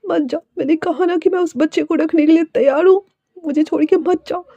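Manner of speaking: high-pitched tearful begging; tearful pleading. Acted. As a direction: plead as if close to tears. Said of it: "फिर रोते हुए कहती है".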